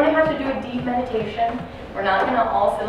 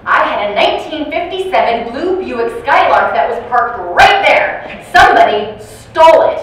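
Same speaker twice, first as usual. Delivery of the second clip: mock-upset old lady insisting her car was stolen; insistent complaint; 3 semitones higher, 9 dB louder than usual. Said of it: "she goes".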